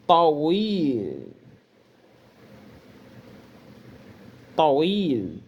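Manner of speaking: Tawil with a long i; Arabic word Tawil. Shown as a demonstration. Teacher models the long vowel in Tawil for students. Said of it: "Percebam que eu tô pronunciando".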